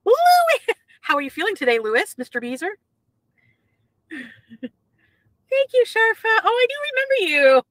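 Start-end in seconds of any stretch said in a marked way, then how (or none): 0.0-0.6 high, loud excited greeting; calling out. Real, not acted. Calling out to a viewer. 4.1-7.6 excited greeting. Real, not acted. Greeting a chat viewer.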